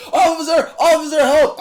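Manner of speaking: high, loud mock cry for help; panicked scream. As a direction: scream for the officer, hammed up. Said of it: "starts screaming".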